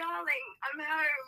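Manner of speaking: hammed-up slow Cockney greeting; Cockney accent. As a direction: draw it out in a put-on Cockney voice. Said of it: "like, Cockney".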